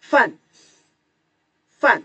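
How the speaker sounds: "Fan" with a short, high falling tone; nada keempat. Shown as a demonstration. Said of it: "Fan ada empat". ("Fan" nada empat, tinggi lalu jatuh)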